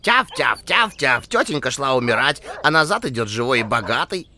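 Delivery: loud yapping little dog voice; yappy panting puppy. Acted. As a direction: keep yapping like a small dog. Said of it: "продолжила тявкать".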